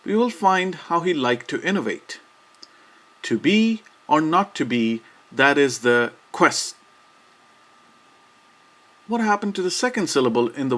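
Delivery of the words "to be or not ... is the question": To be or not to be, that is the quest, stopping short on quest; cut-off line. Shown as a demonstration. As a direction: break off the last word as quest. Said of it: "one of his most famous lines".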